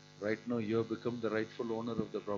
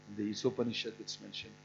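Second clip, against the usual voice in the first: ordinary delivery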